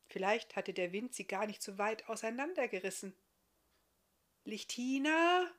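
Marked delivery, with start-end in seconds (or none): 4.4-5.5 drawn-out, higher, tentative call; timid call. A narrator's reading. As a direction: call out hesitantly, not at full voice. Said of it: "rief er erst zaghaft".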